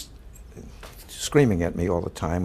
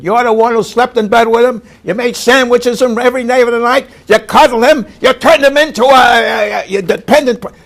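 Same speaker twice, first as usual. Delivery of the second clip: much higher, far louder furious yell; screaming back; 14 dB louder than usual. Retold or imitated. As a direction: blast it back at her full volume. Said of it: "everybody started screaming".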